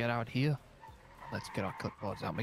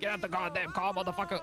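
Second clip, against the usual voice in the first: angry shout; 7 semitones higher than usual. Pretend. Hammed-up carjacking demand.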